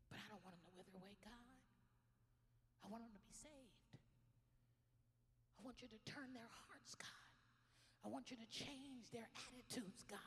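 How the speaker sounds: quiet, earnest whispered prayer to God; hushed prayer. Real, not acted. Pastor praying for her enemies, hushed. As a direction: plead softly.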